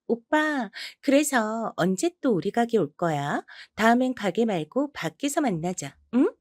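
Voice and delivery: high, slow, sugary cooing to her regular; coy bar hostess. Acted. Bar hostess coaxing the husband to meet outside, slow coy high coo. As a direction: coo it slowly like a flirty hostess.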